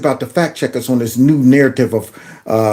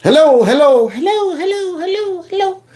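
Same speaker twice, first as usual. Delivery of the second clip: high fading echoing hellos; echo into hollow; 12 semitones higher than usual. Pretend. Calling into a man's empty chest, echoing and fading. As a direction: call down a hollow well, fading away. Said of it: "yell down there, to be hollow".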